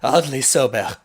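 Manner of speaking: manic Eddie. Acted. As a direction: play a manic man announcing himself, loudly. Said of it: "turns around with a manic face".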